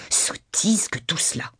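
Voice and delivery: slow, whispery exclamation; dismissive. A narrator's reading. Wife dismisses her husband's whole proposal, dismissive. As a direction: draw it out in a whispered huff.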